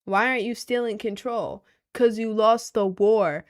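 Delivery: ordinary delivery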